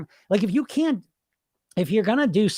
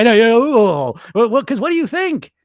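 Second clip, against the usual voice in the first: excited outburst; 6 semitones higher, 7 dB louder than usual. Real, not acted. Excited rant, laughing.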